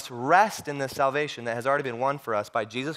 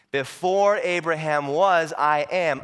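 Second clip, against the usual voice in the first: slow, forceful preacher's proclamation; firm, stretched-out emphasis; emphatic declaration; 4 dB louder, slower than usual. Acted. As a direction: proclaim it in full voice.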